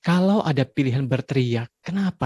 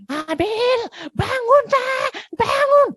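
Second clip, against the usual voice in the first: much higher shouted wake-up call; yelling to wake child; 4 dB louder, much slower than usual. Retold or imitated. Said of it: "supaya nggak teriak-teriak". (berteriak membangunkan anak di pagi hari)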